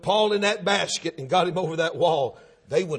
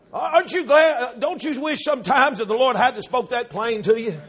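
normal talking voice

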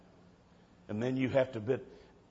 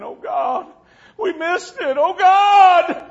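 high, loud wailing cry; 14 dB louder, much slower than usual. Retold or imitated. Left-behind kids crying out to God, wailing. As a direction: wail it like you missed the rapture. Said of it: "they started crying".

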